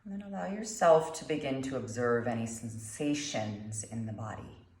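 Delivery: calm meditation guide, long pauses between phrases; guided meditation. Acted. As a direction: guide them gently through the breath, unhurried.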